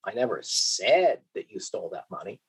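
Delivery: "said" pushed up and held; stress on SAID. Shown as a demonstration. Lecturer showing students emphasis on "said". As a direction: mark "said" as if it were only implied. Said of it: "depending on where I put the emphasis".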